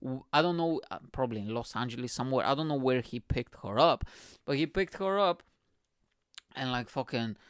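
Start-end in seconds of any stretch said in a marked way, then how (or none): none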